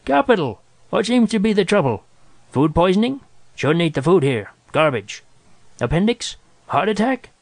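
high-pitched, excitable doctor; acted doctor thrilled to have a patient; eager exclaiming. A narrator's reading. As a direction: fire off hopeful questions with theatrical delight. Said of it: "he exclaimed".

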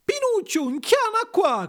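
very high puppet call; high, excited puppet summons, drawn out at the end; Arlecchino crying out. A narrator's reading. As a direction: call out in a squealing puppet falsetto. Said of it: "gridò Arlecchino".

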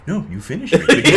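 hammed-up chirpy bear, loud at the end; high cheerful bear voice. Co-host voices the bear praising the writer, chirpy and high. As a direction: chirp it out like a proud robot bear.

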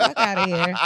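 laughing dismissal. Real, not acted. Laughing off the joke.

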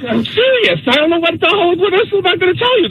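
high-pitched retold protest; exasperated insistence. Retold argument with disbelieving mom.

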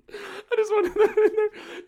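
very high, slow, squeaked out through laughter; helpless laughing. Real, not acted. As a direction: barely get it out for laughing. Cracking up at own joke.

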